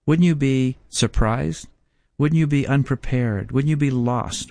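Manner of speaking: ordinary talk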